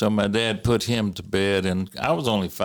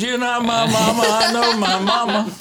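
higher, louder, laughing protest; laughing disbelief; 11 semitones higher, 5 dB louder than usual. Retold or imitated. Son refusing to believe his mother on the phone, laughing. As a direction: protest through laughter that she can't be mom. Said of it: "I said".

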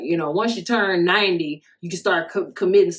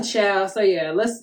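ordinary talk, nothing marked

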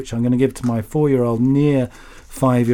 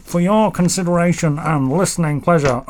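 Jimmy Savile; 5 semitones higher than usual. Retold or imitated. Host reading a listener's letter, hammed-up presenter voice. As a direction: draw the words out in a mock presenter voice. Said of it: "Jimmy Savile".

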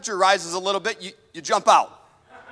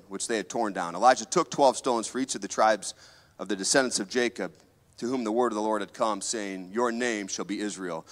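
scripture reading; 7 semitones lower than usual. Acted. Preacher reading Elijah's story to the church, solemn. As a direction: read the story gravely. Reads the Bible passage aloud.

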